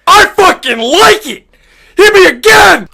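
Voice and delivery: high, loud hammed-up pleasure yell; ecstatic shouting. Badger begs for more.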